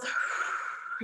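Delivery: long, soft breath out; exhale through mouth. Shown as a demonstration. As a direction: demonstrate a deep, slow exhale through the mouth. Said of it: "out through the mouth".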